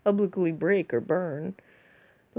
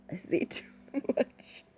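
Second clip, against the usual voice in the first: laughing; 4 semitones higher than usual. Real, not acted. Laughing at own oversharing.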